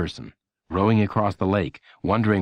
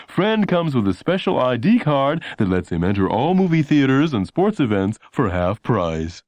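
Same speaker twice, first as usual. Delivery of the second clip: higher ad voice, slowing at the end; announcer aside; 5 semitones higher, 3 dB louder than usual. A narrator's reading. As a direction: drop in the bonus like a pitchman. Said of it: "The announcer would say quietly".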